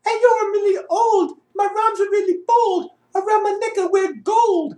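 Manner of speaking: high falsetto British lady rapping; British accent. Acted. Auditioner feeds the rap back to her, hammed-up British accent. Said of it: "do this in a British accent".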